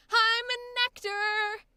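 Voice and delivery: loud, slow, proud declaration. Shown as a demonstration. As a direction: proclaim it to the room. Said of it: "I want you to say".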